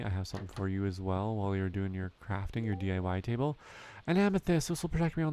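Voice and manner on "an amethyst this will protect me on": a hint of a delighted villager; lightly voiced Emily. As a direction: gasp it out like a thrilled young woman. Reading Emily's gift reaction aloud.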